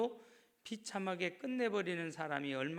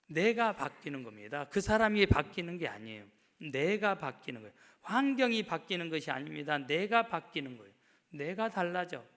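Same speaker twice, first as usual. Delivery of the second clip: loud, pounding sermon; full-force sermon, 내가 stressed; fiery preaching; 7 dB louder than usual. Acted. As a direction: drive each line home to the crowd.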